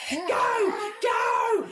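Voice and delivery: high, shrill urgent shout. Acted. Raccoon yelling to go.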